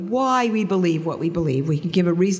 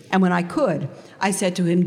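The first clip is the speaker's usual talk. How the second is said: usual speaking voice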